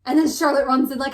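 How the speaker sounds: ordinary delivery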